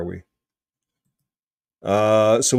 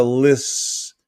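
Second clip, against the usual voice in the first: ordinary delivery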